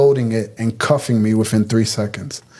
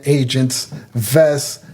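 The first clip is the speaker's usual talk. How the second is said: usual speaking voice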